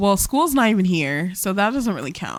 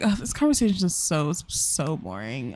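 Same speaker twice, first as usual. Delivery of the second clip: bored character; 5 semitones lower, 6 dB quieter than usual. Acted. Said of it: "She's like".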